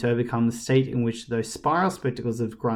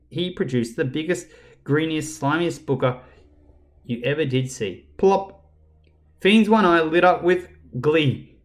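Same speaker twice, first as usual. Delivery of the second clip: plain reading voice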